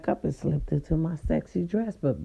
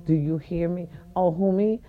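usual speaking voice

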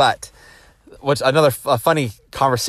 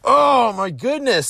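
stunned; 13 semitones higher, much slower than usual. Retold or imitated. Reacting to teacher never letting kids out.

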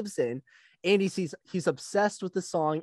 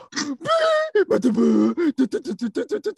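high, loud mouthed theme tune; rapid da-da-da imitation of heroic music; imitated movie fanfare; 9 semitones higher, 6 dB louder than usual. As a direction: blare out a mock film fanfare.

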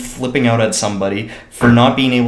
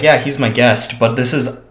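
ordinary talk

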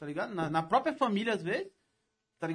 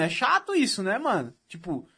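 normal talking voice

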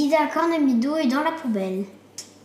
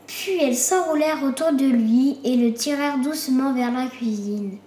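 usual speaking voice

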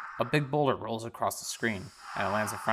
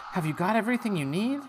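higher caring mum; asking her son, pitched up; mother voice; 10 semitones higher than usual. Acted. Mother checking Isaac downstairs, high voice. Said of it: "and says".

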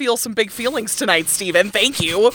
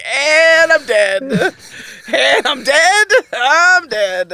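slow, loud, dramatic deadpan "I'm dead"; mock dying; 6 semitones higher, 6 dB louder, much slower than usual. Telling her friend how his cuteness kills her, mock dying loudly. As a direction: play being slain by cuteness. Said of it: "I'm just like".

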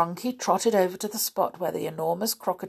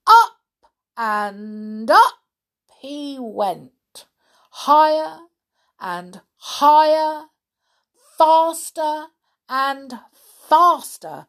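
high, loud, building storytelling; excited narration; 6 semitones higher, 7 dB louder, slower than usual. A narrator's reading. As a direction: ride the rising rocket. Rocket climb narration.

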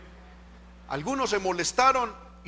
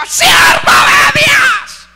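very high, drawn-out full-force shout; imitated prophetic scream; 18 dB louder, much slower than usual. Preacher imitates people shouting prophecy into his ear, screaming. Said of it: "Y le pegan ese grito".

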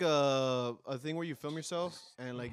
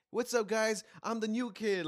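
mock cheerful new-kid voice, pitched higher; pretend new kid; 7 semitones higher, slower than usual. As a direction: greet like a fake new kid. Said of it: "he tries to pretend he's someone else".

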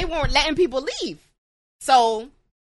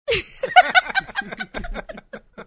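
high burst of laughter. Real, not acted. Laughing at own joke.

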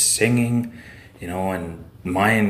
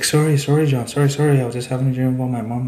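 higher, hurried retold apology; flustered apology; 4 semitones higher, faster than usual. Buddy apologizes to John after his nightmare, higher flustered apology. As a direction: imitate an apologetic friend just out of a dream.

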